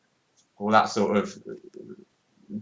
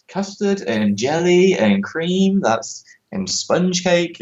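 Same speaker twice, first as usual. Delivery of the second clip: high, slow disbelieving quote of baffled outsiders; incredulous foreigners; 9 semitones higher, much slower than usual. Retold or imitated. Foreigners baffled by British trifle, slow incredulous. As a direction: drag it out like you can't believe the dessert. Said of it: "and they're like".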